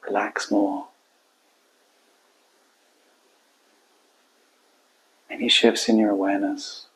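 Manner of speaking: quiet, spacious meditation voice; guided meditation. Acted. Coach guiding listeners to hold client and heart, quiet meditation. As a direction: let each phrase settle into a long silence.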